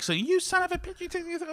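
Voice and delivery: high-pitched imitated cursing; mock angry woman. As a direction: sputter it high like an irate streetwalker. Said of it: "And, and she's like".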